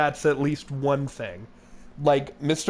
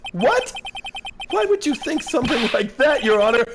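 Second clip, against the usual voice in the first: voicing an indignant witness protesting to the judge; shocked outrage; 10 semitones higher than usual. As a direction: squeak out the protest in disbelief. Indignant reaction voiced in courtroom.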